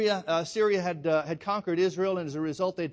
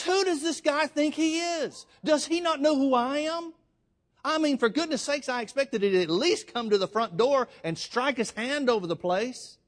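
much higher and louder, an offended rant; indignant outrage; 8 semitones higher, 3 dB louder than usual. Retold or imitated. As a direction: play the insulted great man, huffing. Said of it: "gets totally upset".

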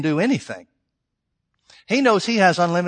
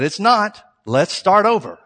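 higher, louder no-nonsense retort; firm correction; 5 semitones higher, 7 dB louder, slower than usual. Retold or imitated. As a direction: cut the doubt off loudly and start afresh. Retells firmly correcting the crying woman.

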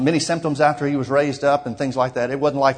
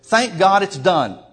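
slow, drawn-out cry of thanks, a little louder; shouting; 5 semitones higher, 4 dB louder, slower than usual. Retold or imitated. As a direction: proclaim it's done, stretching each word. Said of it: "shouted to the top of my voice".

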